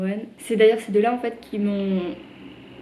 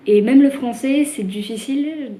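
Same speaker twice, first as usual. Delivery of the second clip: emphatic burst; 4 semitones higher, 7 dB louder than usual. Real, not acted. Exclaiming French is also hard.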